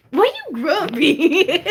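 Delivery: high, slow, laughing tease; laughing teasing. Real, not acted. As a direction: tease him through laughter. Teasing her partner.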